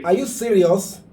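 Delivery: high, loud incredulous question; shocked disbelief. Real, not acted. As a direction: react in real astonishment to the caller. Host reacts to caller's shocking account.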